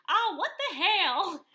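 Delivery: high, loud, drawn-out mock shock; hammed-up startled exclamation; shocked outburst. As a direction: imitate someone appalled by what they were handed. Retold shock at a strong drink.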